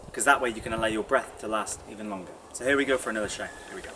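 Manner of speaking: normal talking voice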